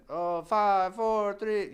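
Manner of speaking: loud, high pressure-cooker countdown; mock screamed countdown. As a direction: imitate the screaming as time runs out. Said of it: "screaming and the pressure".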